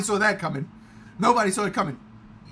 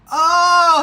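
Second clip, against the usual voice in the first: a much higher, louder "oh"; mock wife catching on; sudden realization; 10 semitones higher, 12 dB louder than usual. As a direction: realize it all at once. Wife suddenly gets the joke.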